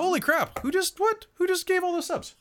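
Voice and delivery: real high squeals of surprise and delight; excited. Real, not acted. Streamer thanking Drew for gifted subs, shrieking with joy. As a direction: gasp it out, thrilled by the sudden gift.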